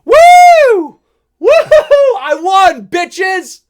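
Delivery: loud, high victory yell; triumphant whoop. Retold or imitated. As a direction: whoop like you just won the lottery. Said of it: "as if he's going".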